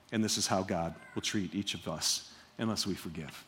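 hushed sobering close; grave hush. Acted. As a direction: let the weight settle. Sobering conclusion.

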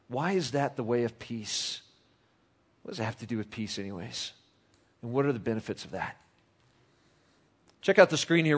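ordinary delivery

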